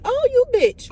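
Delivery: loud, high indignant cry; outraged. Retold or imitated. Cursing the officer who walked off, loud and high. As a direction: fling it after her as she walks away.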